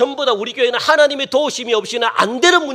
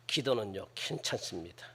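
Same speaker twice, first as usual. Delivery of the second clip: very soft and low; murmured, far too placid; mock quiet prayer; 12 semitones lower, 17 dB quieter than usual. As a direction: play someone praying with no urgency at all. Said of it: "큰 소리 안 치는 사람 못 봤어요".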